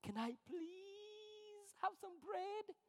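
very high, slow, soft pleading; high whiny beggar asking for bread at the table; begging. Pretend. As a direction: beg meekly like a timid child. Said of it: "you begin begging".

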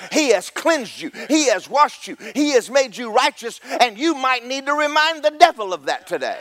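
high-pitched, driving sermon delivery; full-force preaching. Real, not acted. Preacher assuring congregation they are made righteous, impassioned high preaching. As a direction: preach it in rising, unbroken waves.

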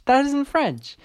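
ordinary talk

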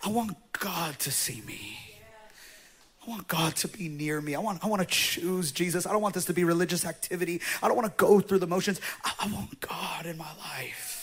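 almost a tearful whisper; near tears. Real, not acted. Pastor pleading before God, in tears. Emotional confession after choking up.

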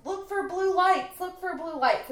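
high, loud repeated mock command; mock urgent order. Recapping a TV scene, imitating the crew's urgent mock order. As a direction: bark it out urgently.

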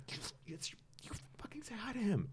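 urgent, insistent whispered command; fierce whisper. Retold or imitated. Girlfriend urges boyfriend to greet Taika, fierce whisper. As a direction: whisper the order through your teeth.

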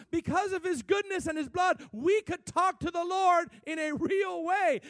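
loud and high, shouting the good news; full-force preaching. Acted. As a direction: preach it with everything you've got.

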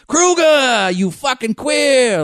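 put-on high, drawn-out heckle in Boston accent; imitated Boston heckler. Fan heckling a player, mock Boston yell. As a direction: holler it across a stadium, slowly. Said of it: "I can hear that vo".

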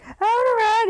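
high, put-on childish plea; begging girl. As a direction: beg like a little girl. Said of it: "is, like, so beautifully begging".

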